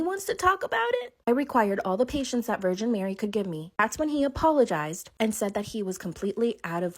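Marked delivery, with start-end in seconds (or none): 0.0-1.1 can you believe this guy; high incredulous squeak of outrage; outraged disbelief. Real, not acted. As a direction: pitch shoots way up on about. Outraged retelling of his reply.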